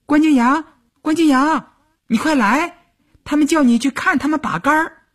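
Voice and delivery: put-on high, bright child's call through the window; calling out like a little girl. A narrator's reading. Girl soldier calls her friend from the window, high childlike call. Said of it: "就踮着脚尖儿朝窗户里喊".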